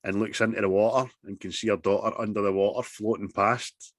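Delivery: normal talking voice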